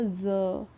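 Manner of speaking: ज said as a buzzing za; ज like English zebra; nukta za sound. Shown as a demonstration. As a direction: say ज with a z, not ja. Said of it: "जा के नीचे बन जाएगा".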